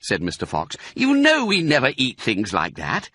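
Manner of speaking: high, exasperated put-down; scolding father. Acted. Fox rebukes his cub over carrots, scolding. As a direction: dismiss the silly idea.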